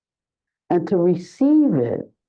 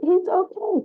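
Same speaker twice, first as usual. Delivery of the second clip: much higher, light mock voice of her; high sweet imitation of her delight; imitating Sada; 13 semitones higher than usual. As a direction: pipe it up high like Sada, unbothered. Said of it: "And she was like".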